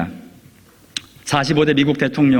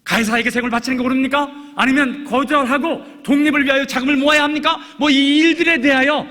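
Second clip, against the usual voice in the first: raised, ringing preacher's voice, much higher; fervent preaching; 11 semitones higher than usual. Acted. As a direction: drive the point home from the pulpit. Preaching at full force.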